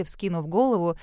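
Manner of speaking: usual speaking voice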